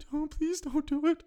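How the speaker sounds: high, pleading falsetto; mock pleading clerk. Clerk behind the manager begging, in a high pleading voice. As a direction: beg him not to, voice up high. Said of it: "she was still behind him".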